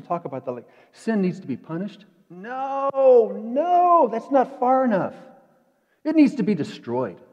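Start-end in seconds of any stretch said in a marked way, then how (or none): 2.3-7.2 much higher and louder protest; preacher rising to full force against a weak idea; emphatic no. Real, not acted. Preacher rejects mere punishment.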